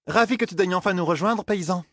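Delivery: high-pitched sarcastic welcome; mocking jibe. A narrator's reading. Zarine greets the late peasant, mocking. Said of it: "railla Zarine".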